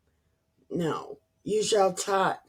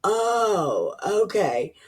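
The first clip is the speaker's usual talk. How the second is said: ordinary delivery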